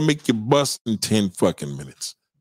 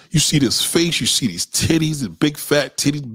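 normal talking voice

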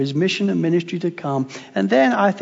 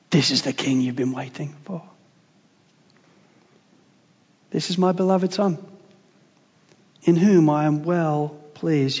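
tender reverent voice; 6 semitones lower, 6 dB quieter than usual. Acted. Voicing God the Father's words.

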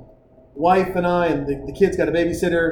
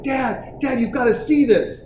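put-on excited kid; 7 semitones higher, slower than usual. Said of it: "she's like".

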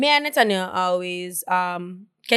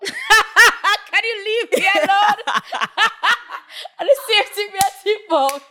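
high, drawn-out words through laughter; real laughing fit; 10 semitones higher than usual. Real, not acted. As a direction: barely get it out for laughing. Both cracking up mid-chat.

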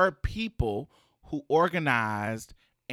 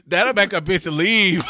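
normal talking voice

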